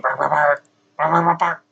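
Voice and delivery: low gruff barking voice; dog barking. Retold or imitated. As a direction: bark like a big dog. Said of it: "Burr would be going".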